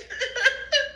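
very high giggling laugh; helpless high cackle; laughter. Real, not acted. High speaker amused by her own tears, laughing. Cracks up over crying.

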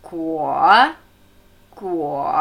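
Guo low, flowing from u into a weighted o; low third-tone Guo leaning on the o; stress on O. Shown as a demonstration. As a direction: glide through u and land on the o in Guo. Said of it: "Betonung auf O".

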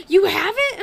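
sudden loud, high "You have it?"; alarmed question. Retold or imitated. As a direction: blurt it out in alarm. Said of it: "and then she's like".

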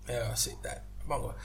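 small, subdued voice retelling an awkward answer; sheepish reply. Retold or imitated. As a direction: trail off quietly, embarrassed. Retelling his sheepish reply to her.

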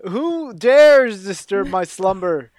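slow, loud booming ancient voice; ancient rasping undead king. Acted. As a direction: boom it out like a woken ancient king. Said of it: "At this point".

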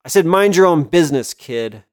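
loud fast threat, dropping at the end; threatening. Acted. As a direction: warn him off hard. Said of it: "clenched a fist".

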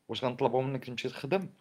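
usual speaking voice

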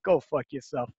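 low, soft, fed-up dismissal; retold upset. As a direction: imitate an irritated man dropping his voice. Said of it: "he was so upset".

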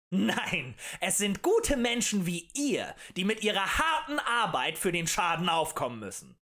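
rousing agitator's declaration; emphatic oratory. A narrator's reading. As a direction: champion the common people. Agitator harangues the tavern crowd.

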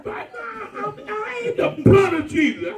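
mock protest. Retorting to the shouting churchgoer.

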